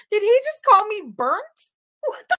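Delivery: high, loud outraged question; offended disbelief; sharp rising what the; indignant shock. Real, not acted. Outraged at insult.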